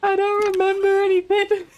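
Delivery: very high, squeaky playful whine; mock wail. Streamer whose brain is broken, high mock wail. Mock despair at failing memory.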